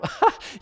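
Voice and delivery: a short high scornful laugh; mocking laugh. God in heaven laughing at puny rebels, high scornful laugh.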